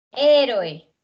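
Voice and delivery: "Héroe" loud, with the force on the first syllable; esdrújula stress. Shown as a demonstration. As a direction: hit the opening syllable of "Héroe" hard. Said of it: "se convierte en esdrújula". («Héroe» con la fuerza en la primera sílaba)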